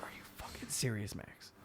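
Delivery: near-silent whisper to a friend; retold whispered reproach; hushed disbelief. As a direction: hiss it under your breath, barely audible. Muttering to friend in danger.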